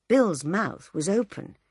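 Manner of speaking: usual speaking voice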